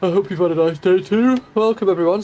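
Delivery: words stretched through a big yawn. Real, not acted. Streamer yawning through a welcome to chat. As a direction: yawn it out while greeting chat. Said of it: "Sorry, I'm not yawning at you".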